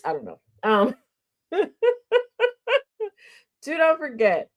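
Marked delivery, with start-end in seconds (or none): 1.5-3.1 light laugh, high up; high giggle. Real, not acted. Host giggling between reminders, laughing high. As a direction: let a high giggle slip out.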